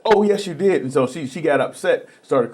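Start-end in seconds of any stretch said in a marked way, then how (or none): 0.1-0.8 insisting waitress. Retold or imitated. Waitress insisting in retold story.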